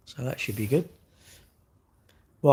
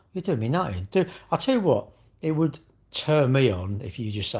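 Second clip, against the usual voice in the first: ordinary talk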